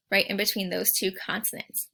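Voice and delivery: usual speaking voice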